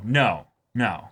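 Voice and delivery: ordinary delivery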